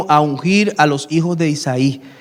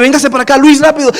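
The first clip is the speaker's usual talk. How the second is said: higher, louder hurried call; urgent nurse call; 6 semitones higher, 5 dB louder, faster than usual. Retold or imitated. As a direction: summon him in a rush. Nurse urgently calls him in.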